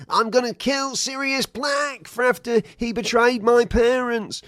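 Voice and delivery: high, loud, impassioned rant; mock vengeful screaming. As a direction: scream a hammed-up vow of revenge. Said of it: "you've been screaming".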